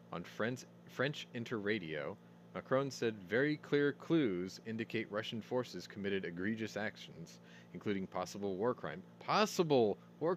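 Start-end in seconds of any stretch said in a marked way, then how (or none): none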